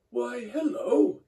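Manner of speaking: high, loud and slow, drawn out; old engine's cheery hello. Acted. Old engine calls out hello to startled Boko, high and drawn out. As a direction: greet the visitor with a big surprise.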